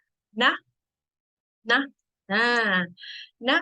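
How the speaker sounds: teacher drilling นะ for students to copy; model Thai particle. Shown as a demonstration. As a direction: clear drilled นะ, said over and over. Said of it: "repeat after me".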